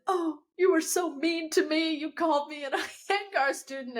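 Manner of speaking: high, whiny tearful complaint; mock crying. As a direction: complain like someone wronged and weeping. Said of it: "started crying more".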